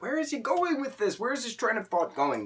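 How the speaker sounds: high incredulous mock viewer; confused viewer. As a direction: ask it like a baffled viewer. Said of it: "you're like".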